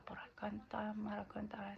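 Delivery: whispered made-up language. Shown as a demonstration. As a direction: whisper the invented words. Love language sample drops to whisper.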